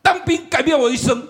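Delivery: 高声响亮地宣告; 抬高声音抓住全场注意; bold announcement. Retold or imitated. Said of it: "我就". (在乱哄哄的台下面前，高声宣告)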